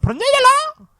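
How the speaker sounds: "Preneur" extremely high, loud and stretched upward; silly falsetto squeal. Pretend. Host topping co-host's echo, silly falsetto "Preneur". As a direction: squeal "Preneur" like a cartoon question.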